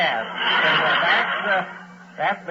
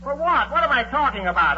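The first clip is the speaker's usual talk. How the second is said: exasperated outburst; 11 semitones higher, 5 dB louder than usual. Acted. Husband rants about paying tax.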